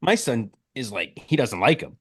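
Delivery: ordinary talk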